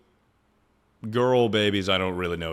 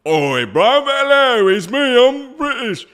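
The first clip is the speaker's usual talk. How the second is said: hammed-up British accent, much higher and louder; a silly fake British accent, pitched way up; mock British accent; 10 dB louder, slower than usual. Shown as a demonstration. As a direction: overdo a cartoon British voice, loud and high. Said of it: "and then still sound like this".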